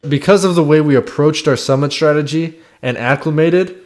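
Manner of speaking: ordinary talk, nothing marked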